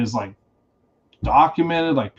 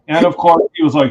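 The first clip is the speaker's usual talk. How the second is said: ordinary talk, nothing marked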